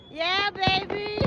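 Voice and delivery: normal talking voice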